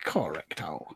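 breathy playful whisper. Real, not acted. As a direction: whisper it like a secret. Playful quiet confirmation.

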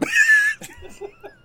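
high whooping laugh; loud laughing shout. Real, not acted. Laughs at own wrong word.

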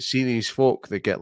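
usual speaking voice

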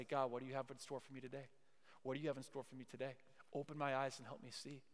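softly voiced personal prayer, fairly quick; quiet, earnest morning prayer; hushed prayer. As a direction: say it low-key, as a private request to God.

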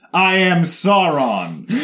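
slow, loud, high grand announcement; dramatic villain reveal. Retold or imitated. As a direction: declare it like a cartoon villain. Mock villain self-reveal.

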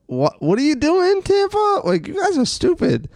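high, incredulous yelling at the team; ranting up high in disbelief; mock outraged rant. Fan yelling at Tampa for firing the coach, high incredulous rant. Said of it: "I was like".

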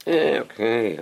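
acted unhurried, grudging "okay"; mock half-hearted compliance; reluctant child. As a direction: agree with no urge to move. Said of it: "The third one".

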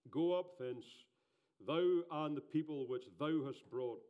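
loud, solemn scripture reading; booming biblical narration. Acted. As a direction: proclaim the verse. Reads scripture aloud.

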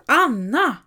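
loud high yell of the name; shouting. A narrator's reading. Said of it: "han skriker av skratt".

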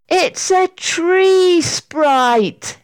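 high, drawn-out cry of discovery; astonished storybook shout; excited shout. A narrator's reading. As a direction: call it out slowly in amazement. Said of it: "shouted Sarah".

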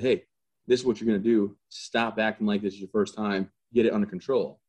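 ordinary talk, nothing marked

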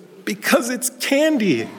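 put-on high, slow, whiny child voice; little boy's protest. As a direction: imitate a tearful boy protesting. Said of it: "And the boy says".

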